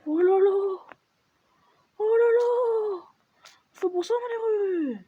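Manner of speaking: high, drawn-out excited cry; excited exclamation. Real, not acted. Gamer reacting to the game, high excited oh là là. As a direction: exclaim with rising excitement, stretching it out.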